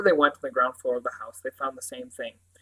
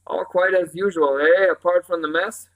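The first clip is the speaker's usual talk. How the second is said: higher, louder Cornish sergeant; warm Cornish accent; 4 semitones higher, 7 dB louder than usual. A narrator's reading. Sergeant asking a boy about the mess, Cornish accent. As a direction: draw out the question in Cornish. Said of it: "his warm Cornish accent stroking the words".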